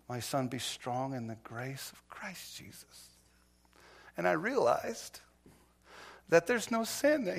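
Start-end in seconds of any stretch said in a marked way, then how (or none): 0.1-3.2 soft whispered verse; whispered scripture. Retold or imitated. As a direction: breathe the verse reverently.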